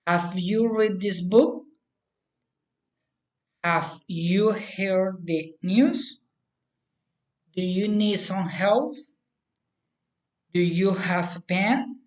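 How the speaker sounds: general questions swept up on "book", "news", "help", "pen"; rising question intonation. Shown as a demonstration. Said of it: "rising intonation".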